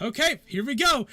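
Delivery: high, loud, keyed-up "here we go"; a guard bracing to open a fiery door, keyed up; eager guard. Acted. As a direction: psych yourself up to open the door. NPC guards bracing before door.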